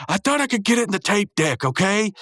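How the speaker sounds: high-pitched defensive whine, acted; mock defensive outburst. Muscle Man excuses his missed throw, imitated defensively.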